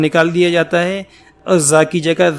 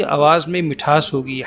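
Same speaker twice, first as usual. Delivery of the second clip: normal talking voice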